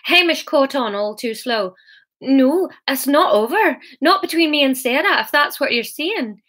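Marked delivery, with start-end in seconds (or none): 2.2-6.4 raised-pitch defiant protest. A narrator's reading. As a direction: dig in and contradict, higher. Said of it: "He was defiant".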